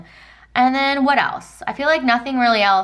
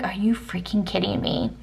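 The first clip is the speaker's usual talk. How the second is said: breathy stage whisper of disbelief; 6 dB quieter than usual. Retold or imitated. Wife whispering in shock at the cracked windshield. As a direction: hiss it under your breath in shock. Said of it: "I was like".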